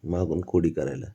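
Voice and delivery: ordinary delivery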